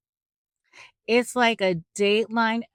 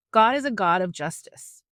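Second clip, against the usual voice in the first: ordinary talk, nothing marked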